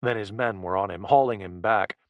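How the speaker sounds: plain reading voice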